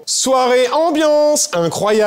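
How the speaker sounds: high, slow, drawn-out party hype; hammed-up announcer call, stretched words; mock party announcer. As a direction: hype the crowd like a club host.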